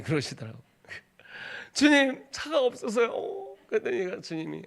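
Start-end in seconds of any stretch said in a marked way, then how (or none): 1.7-3.6 high, slow whimpering plea; pleading. Retold or imitated. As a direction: whine like a helpless child. Pleading answer to God. (느리고 높게 하소연하듯)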